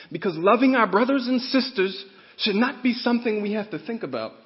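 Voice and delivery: loud, high, fervent preaching; preaching at full force. Real, not acted. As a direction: drive the point home to the congregation.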